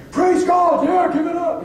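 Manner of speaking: loud, high praise shout; cheering. Retold or imitated. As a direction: whoop it up like a happy churchgoer. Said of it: "some people are like".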